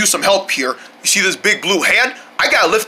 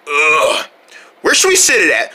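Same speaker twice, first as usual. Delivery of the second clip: usual speaking voice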